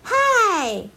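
bright shouted greeting, pitch jumping up; loud hello. Acted. Little bear shouts his cheerful reply to family. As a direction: call it out big and cheerful. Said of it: "熊豆豆总是大声的回答".

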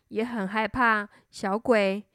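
plain reading voice

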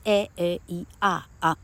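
"A A E R啊" run through as separate short vowels; short vowel drill. Shown as a demonstration. Teacher summing up the rule, short vowel drill. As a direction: list "A A E R啊" as short vowel sounds. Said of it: "那它就念A".

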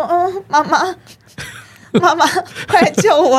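high-pitched frightened plea, half laughing; mock scared. Guest acting out entering a scary cheap motel, mock scared. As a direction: play up a helpless cry for mom. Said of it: "你会吓死".

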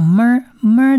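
"么儿" as the sound of Hebrew mem; letter-sound demo. Shown as a demonstration. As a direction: show the m sound as "么儿". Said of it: "我们把它发音成像么儿". (示范希伯来字母读音“么儿”)

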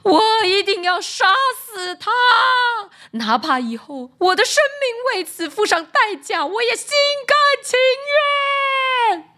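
high, rising furious scream; furious shriek. Acted. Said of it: "狂叫".

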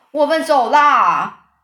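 high, drawn-out bellow; loud holler. A narrator's reading. Old green grasshopper warns everyone they are off, hollering. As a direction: stretch each call long and loud. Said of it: "高声喊着说".

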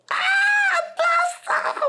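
very high mock shriek of alarm; mother's panicked shriek. Mother yelling up from the first floor about fever, shrill panicked shriek. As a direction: shriek it like a frantic mom downstairs. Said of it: "她就大叫说".

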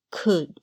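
could with no L sound; silent L. Shown as a demonstration. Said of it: "L不读出来的单字还有". (could的L不读出来)

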